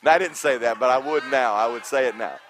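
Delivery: loud, bright burst after the laugh; loud, amused admission; loud laughing aside. Real, not acted. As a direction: blurt it out still laughing.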